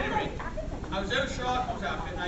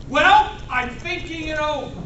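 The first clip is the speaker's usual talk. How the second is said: higher, louder comic outburst; Jack Benny; 6 semitones higher, 11 dB louder than usual. Retold or imitated. Cornered victim answering a robber after dead silence, loud comic retort. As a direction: burst out with a mock-exasperated punchline. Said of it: "quintessential Jack Benny moment".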